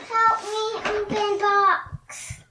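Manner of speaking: robot voice. Real, not acted. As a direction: talk like a robot. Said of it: "speaking like a robot".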